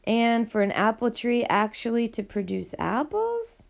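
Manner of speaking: ordinary delivery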